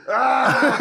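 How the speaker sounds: high, laughing cry of pain; retelling the night pain, high laughing cry. Retold or imitated. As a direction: wail in mock agony. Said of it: "It was".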